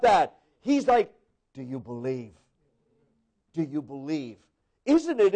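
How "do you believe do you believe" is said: hushed, much lower voice of God asking. Retold or imitated. God asking the faithful, low and hushed. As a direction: lean in low and soft as God.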